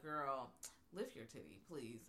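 flat officer order, dropped low; low deadpan cop. Retold or imitated. Police officer to Helen at the station, low and deadpan. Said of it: "the police officer's like".

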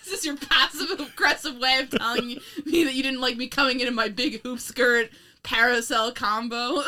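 mock offended, laughing through it; playfully outraged, voice cracking with laughter. Mock offended teasing partner.

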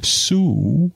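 Sue said as a drawn-out Soo; Sue as spelled Soo. Shown as a demonstration. Husband calling his wife's name, Soo. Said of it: "It's spelled S O O, so I'm trying to replicate that".

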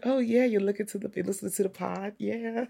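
high, soft coy imitation; mock flattered modesty. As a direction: play coy about being noticed, softly. Imitating flattered podcaster, fake modest.

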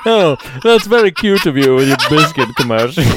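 hammed-up, amused one-upmanship; mock condescension. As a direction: patronise them playfully through a laugh. Playing along as the condescending one-upper.

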